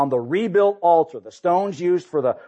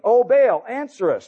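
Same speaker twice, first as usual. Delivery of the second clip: higher, louder cry to Baal; mock shouting prayer; 10 semitones higher, 4 dB louder than usual. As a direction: call out to Baal as the frantic prophets.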